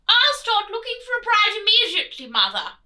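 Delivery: loud, high, hurried prince voice; eager prince. Acted. Prince hastily promising his mother the Queen, loud and fast.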